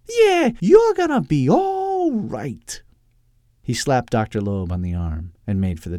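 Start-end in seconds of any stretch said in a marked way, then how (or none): 0.1-2.8 very high, loud, drawn-out approval; delighted little sidekick. Acted. Topper slapping Doctor Loeb's arm, delighted high voice. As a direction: stretch it out with gleeful approval. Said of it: "slowly broadened into a smile".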